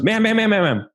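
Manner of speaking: a nurse trying to break in; urgent nurse. Retold or imitated. Nurse interrupts the ranting mom.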